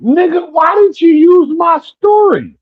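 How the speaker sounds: high-pitched, drawn-out outraged dad; high squeal of disbelief, mimicking a father; dying father. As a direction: imitate Dad's exasperation way up high, stretching the words. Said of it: "And he's like".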